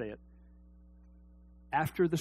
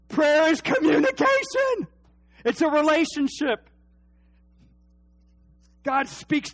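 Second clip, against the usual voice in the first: loud, high preaching shout; rising to a preacher's shout; full-force preaching; 11 semitones higher, 8 dB louder than usual. Acted. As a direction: build the sermon to a cry.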